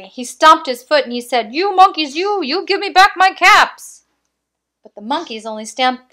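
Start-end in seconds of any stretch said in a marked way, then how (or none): 1.5-4.0 much louder, higher angry shout; quite angry peddler. Acted. Peddler stomping his foot, shouting at the monkeys. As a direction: stamp your foot and shout it. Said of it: "now he felt quite angry".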